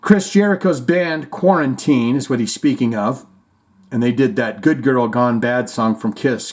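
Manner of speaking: normal talking voice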